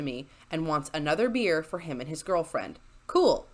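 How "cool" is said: sarcastic cool. Acted. Sarcastic reaction to request.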